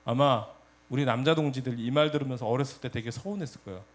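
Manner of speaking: ordinary talk, nothing marked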